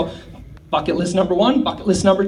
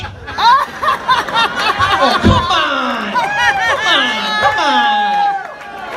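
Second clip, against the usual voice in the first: higher, laughing come on; laughing protest; 11 semitones higher, much slower than usual. Real, not acted. Comedian protesting the crowd's dirty laugh, laughing. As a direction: protest through real laughter.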